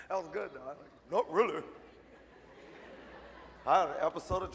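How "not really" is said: 1 mock deep voice. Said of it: "Yeah, deep voice".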